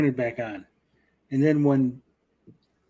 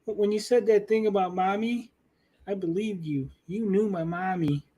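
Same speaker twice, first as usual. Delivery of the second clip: put-on childish Leia; 7 semitones higher than usual. Mocking young Leia talking to Obi-Wan, high childish voice. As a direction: play a small child saying mommy. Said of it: "the little part where Leia's like".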